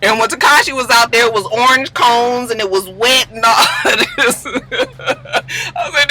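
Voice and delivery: normal talking voice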